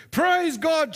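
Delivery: high-pitched joyful cry; acted praise shout. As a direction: exclaim it as the delighted old lady.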